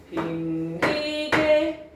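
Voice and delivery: "빙기게" chanted very slowly, stepping up high; 중세국어 성조 낭독. Shown as a demonstration. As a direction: stretch "빙기게" on level, rising tones. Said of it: "이렇게 읽어". ("빙기게"를 음 높여 길게 읊기)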